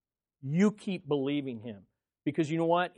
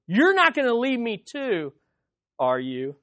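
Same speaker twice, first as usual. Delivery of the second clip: higher, louder pleading question; emotional plea; 7 semitones higher, 6 dB louder than usual. Retold or imitated. Jesus asking the twelve, pleading higher and louder. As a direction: ask it hurt but hoping. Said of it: "he says it in a way that says".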